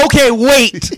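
loud, high eager interruption; excited. Real, not acted.

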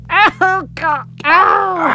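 high pained cries; pained cry. A narrator's reading. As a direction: cry out in pain. In pain, eyes streaming.

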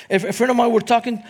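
ordinary talk

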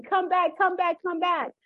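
high repeated mock calls; retold yell. Grandmother calling the runaway toddler back, high repeated yells. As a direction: keep calling him back. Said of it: "And so I was yelling".

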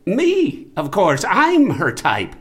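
high, loud and emphatic, slowing at the end; indignant insistence. Acted. Husband interrupts the rapid questions about wife's type, indignant. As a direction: cut off the list with wounded pride. Indignant after long list of types.

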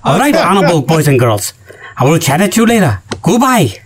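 hammed-up Chinese accent signing off; put-on Chinese accent goodbye; mock Chinese accent.

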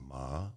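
"ma" gliding upward; "ma" rising, as in Mandarin second tone. Shown as a demonstration. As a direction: lift "ma" like a question. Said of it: "The second tone is rising".

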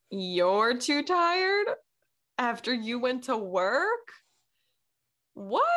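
slow, drawn-out disbelief rising high; incredulous. Retold or imitated. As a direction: stretch it into an appalled question. Acted disbelief at mom being too tired.

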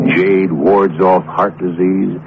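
ordinary talk

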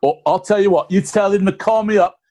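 mock Cockney accent. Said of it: "Cockney accent".

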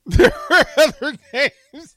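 helpless real laughter on the words; cracking up. Real, not acted. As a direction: laugh hard through every word. Repeats the line cracking up.

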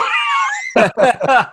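big high burst of laughter. Real, not acted. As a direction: laugh hard at the payoff. Laugh after story's punchline.

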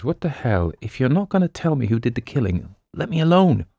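understated irritated brush-off; snorting annoyance. A narrator's reading. Sergeant dismisses Quaid and his wire, mildly snorting. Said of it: "Dickinson snorted".

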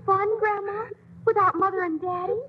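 weepy and disbelieving; tearful protest. Acted. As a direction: question it through tears. Child crying over parents' absence.

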